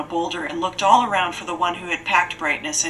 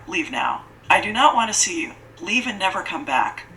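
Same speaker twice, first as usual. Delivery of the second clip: ordinary delivery, nothing marked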